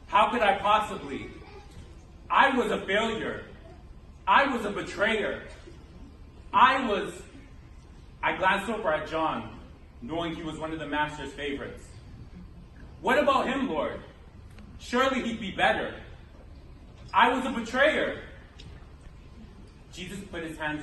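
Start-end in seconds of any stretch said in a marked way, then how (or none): 0.1-7.1 louder, insistent self-accusation; anguished self-reproach. Acted. Peter feels too inadequate for the task, anguished protest. 13.0-18.2 raised, higher desperate objection; agitated protest. Acted. Peter urges the Lord to choose John, agitated protest.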